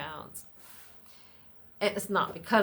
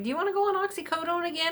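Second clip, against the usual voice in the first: quick, higher mock doctor voice; fast, higher imitation of a doctor asking; 14 semitones higher, 7 dB louder, much faster than usual. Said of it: "he's like".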